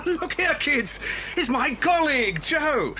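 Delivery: high, slow amazed recognition; Bill amazed to find his colleague Joe; astonished relief. Acted. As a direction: gasp it out with relief.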